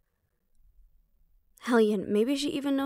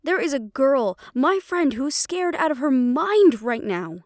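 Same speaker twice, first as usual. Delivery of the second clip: higher and louder, pleading in anger; angry shout; 4 semitones higher, 5 dB louder than usual. Acted. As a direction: yell at mother to make her see. Daughter pleads angrily for friend.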